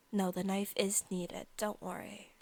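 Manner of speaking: ordinary talk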